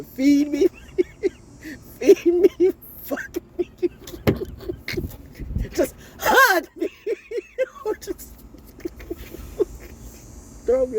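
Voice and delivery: words collapsing into high, squeaky laughter; helpless laughter. Real, not acted. Recalling what her husband didn't know, real helpless laughter. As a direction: laugh till the voice gives out.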